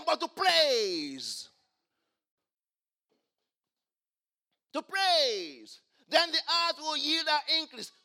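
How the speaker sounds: loud, ringing sermon delivery; full-force preaching. Acted. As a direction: preach it out to the whole church.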